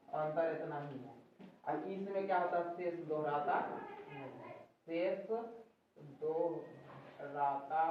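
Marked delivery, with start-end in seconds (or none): 4.1-7.8 words drawn out one by one, softer; slow dictation. Shown as a demonstration. Teacher dictating a definition into pupils' copies, slow dictation. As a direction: dictate slowly and quietly, with pauses between words.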